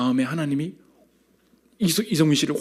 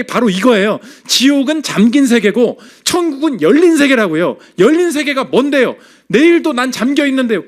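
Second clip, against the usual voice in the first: loud, high, emphatic sermon delivery; impassioned, insistent preacher; forceful preaching; 8 semitones higher, 6 dB louder than usual. Real, not acted. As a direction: drive the point home to the congregation.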